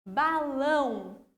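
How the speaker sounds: "Balão" slowly, both syllables held. Shown as a demonstration. Teacher reading test words for classifying, "Balão" syllable by syllable. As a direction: draw out "Balão" as ba-lão. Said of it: "já trouxe separadas em sílabas".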